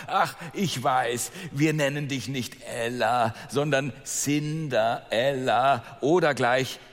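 gedehnte, höhnische Mädchenstimme mit Lachen; spöttische Stiefschwester. Acted. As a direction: zieh jedes Wort spöttisch in die Länge. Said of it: "Und die zweite sagte". (zweite Stiefschwester verhöhnt Ella, langsam und spöttisch)

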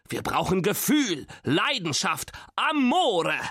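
rising, theatrical passion; grandpa's impassioned plea, pitch soaring; passionate appeal. A narrator's reading. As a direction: build word by word to a high, fervent peak. Grandpa demands passionate Italian music.

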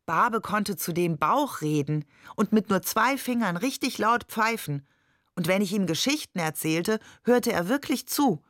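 ordinary delivery

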